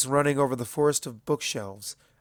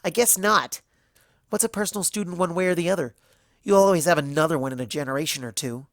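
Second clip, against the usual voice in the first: fast, loud bitter sarcasm; derisive; 5 semitones higher, 5 dB louder, faster than usual. A narrator's reading. Twilight mocking Celestia's neglect, fast and derisive. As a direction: rattle off the scorn. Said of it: "She snorted in derision".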